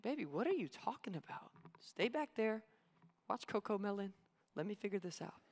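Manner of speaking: soft, calming, a little dismissive; soothing mom. Retold or imitated. Mom softly hushing her kid during the breakdown. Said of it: "She's like".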